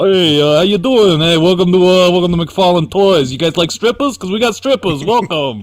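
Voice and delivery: a cocky toy-company host welcoming you in; doing Joe Quesada as a sleazy showman; Joe Quesada impression. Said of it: "That was my Joe Quesada impression".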